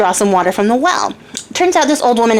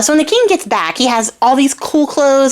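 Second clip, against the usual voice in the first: normal talking voice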